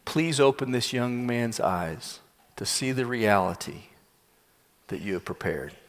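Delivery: low, soft, pleading prayer; hushed prayer. Acted. Prophet prays for the young man's eyes, hushed. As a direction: pray quietly with long pauses. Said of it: "gets on his knees before the Lord".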